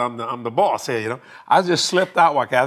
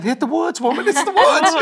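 higher and louder, laughing through it; laughing retort; 12 semitones higher, 3 dB louder than usual. Retold or imitated. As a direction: toss it back, laughing at her. Teasing wife about the bathroom.

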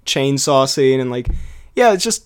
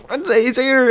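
high, thrilled mock outburst; excited retold exclamation; 9 semitones higher, slower than usual. Had to tell someone he's here, excited high voice. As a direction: relive the thrill in a raised pitch.

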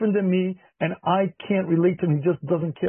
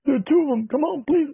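pleading frightened wife; 8 semitones higher than usual. Retold or imitated. Wife phoning about cockroaches.

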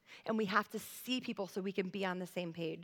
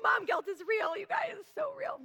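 much higher, strained confession of guilt; high-pitched exclamation; 12 semitones higher, 4 dB louder than usual. Real, not acted. Mom confessing guilt to the congregation, high-pitched exclaiming.

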